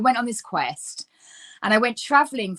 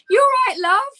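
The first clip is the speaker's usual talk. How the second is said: put-on high, loud chirpy English accent; imitating a cheery English woman calling love; 11 semitones higher, 5 dB louder than usual. To the lost traveler, a bright British "you all right, love". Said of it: "this English woman".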